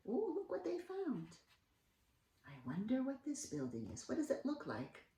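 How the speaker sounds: soft, curious hush; hushed wonder. Acted. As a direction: marvel softly. Wondering with children.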